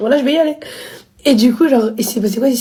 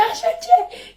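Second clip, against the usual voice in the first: squeaky high mock threat; mock father's threat; 5 dB quieter than usual. Mimicking her father threatening with the knife, high and mocking. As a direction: play the father as a high cartoon.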